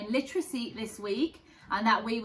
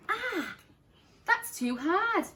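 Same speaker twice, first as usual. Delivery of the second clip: complaining; 10 semitones higher, slower than usual. Acted. Goldilocks trying big chair.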